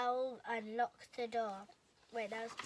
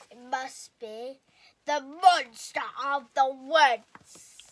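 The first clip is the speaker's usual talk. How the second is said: slow, loud and ominous; dread; 14 dB louder, slower than usual. A narrator's reading. The friends fearing the monster outside, slow and ominous. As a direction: stretch the words out with dread.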